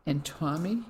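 plain reading voice

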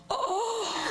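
sharp gasp, high cry of shock. Acted. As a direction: gasp as if seeing a ghost. Shocked at the returned wife.

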